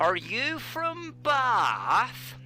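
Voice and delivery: hammed-up West Country accent, vowels drawn out; mock rural Somerset accent, high and slow at first; Hot Fuzz accent. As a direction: put on a broad Bath accent. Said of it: "the Hot Fuzz accent".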